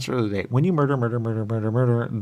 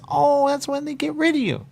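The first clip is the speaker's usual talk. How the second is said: high, louder put-on voice; voiced explainer; 5 dB louder than usual. Quoting the one who explains murder, in a high voice.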